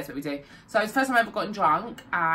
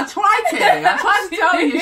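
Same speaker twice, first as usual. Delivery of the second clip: laughing; 10 semitones higher, 8 dB louder than usual. Retold or imitated. Voicing the friend.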